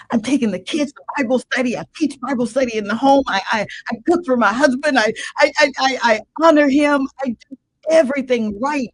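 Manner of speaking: retold angry protest. Retelling her angry protest to God.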